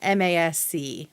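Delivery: ordinary delivery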